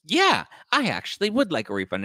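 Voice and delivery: high, put-on entitled voice; hammed-up high indignation; mock huffy customer. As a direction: imitate a customer demanding his due.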